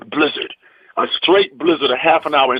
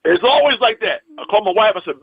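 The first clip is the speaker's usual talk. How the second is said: ordinary talk, nothing marked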